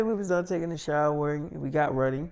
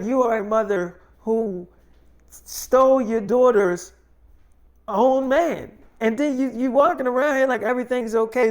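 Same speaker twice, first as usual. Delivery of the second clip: loud, high, emphatic accusation; accusing mother; 5 semitones higher, 5 dB louder than usual. Retold or imitated. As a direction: imitate a mother laying into her daughter. Mother accuses daughter in quarrel.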